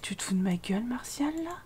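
soft whisper, half to herself; whispered complaint. Real, not acted. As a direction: hiss it under your breath. Hushed complaint at Martial. (chuchotement agacé)

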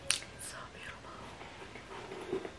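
soft, breathy whisper; hushed wonder; awed whisper. Real, not acted. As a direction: whisper it, overcome. Overwhelmed by the jersey.

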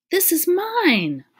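high, loud, drawn-out exclamation; excited claim. A narrator's reading. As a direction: claim it loudly, stretching the words. Exclaims over a found medal.

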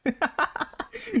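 hammed-up very high ladylike laugh; a haughty rich girl's laugh, way up high; high giggle. High lady laugh reading character.

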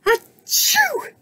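very high, explosive mock sneeze; acted sneeze. A narrator's reading. Snake sneezes at Bird's feathers, high acted sneeze. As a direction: fake a big squealed achoo. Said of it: "sneezed Snake".

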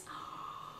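breath caught in delight; gasp of awe. Real, not acted. Seeing the contour kit up close, awed gasp. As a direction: gasp at something pretty.

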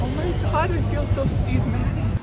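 high, excited gush; excited squeal. Real, not acted. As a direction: squeal with delight. Excited about free shirt.

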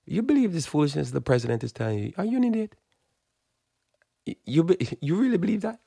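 mock how anyone could believe that; incredulous outburst. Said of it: "you're like".

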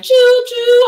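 choo choo, high and loud like a train whistle. Pretend. Imitating a train in story.

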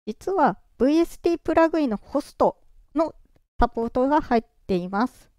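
ordinary delivery

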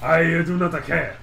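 a hammy ninja warrior line. Pretend. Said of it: "like, Genji and Hanzo mix".

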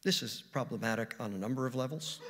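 ordinary delivery

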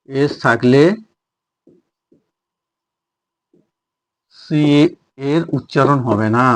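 long gaps between short phrases; halting pace, as if writing along; word-by-word dictation. Shown as a demonstration. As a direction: dictate the rule at writing speed. Dictates the rule while writing.

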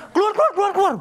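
high, rapid-fire angry ordering out; imitated raging. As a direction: act out a furious healer throwing people out. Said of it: "Waktu dia ngamuk, dia bilang gini". (menirukan orang ngamuk mengusir, nada melengking tinggi)

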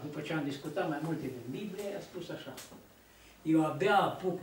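ordinary talk, nothing marked

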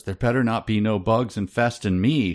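ordinary talk

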